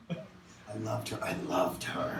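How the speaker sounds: slow, soft whispered "I loved her"; hushed longing repetition; whispered confession. Acted. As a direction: breathe it like a secret. Repeated confession trails to whisper.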